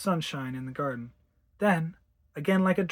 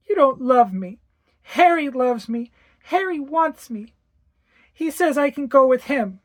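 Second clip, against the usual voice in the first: put-on high, louder little girl, eerily certain; a child turned chillingly adult; eerie little girl, higher and louder; cold child voice; 8 semitones higher, 6 dB louder than usual. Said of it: "an adult stare that made me tremble".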